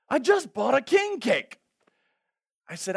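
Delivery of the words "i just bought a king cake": high, loud, giddy shout of news; excited yell. Retold or imitated. Friend on the phone shouting his news, loud and excited.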